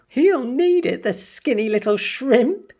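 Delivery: ordinary delivery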